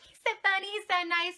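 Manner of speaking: high, excited gushing; gushing fangirl. Retold or imitated. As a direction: swoon over him like a fan. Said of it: "I'm like".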